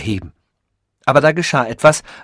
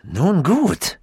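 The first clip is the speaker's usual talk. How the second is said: higher, swooping coo; soft cooing; 5 semitones higher than usual. A narrator's reading. Professor won over by the rude visitor, cooing. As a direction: coo it, pleased with him. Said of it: "girrte er leise".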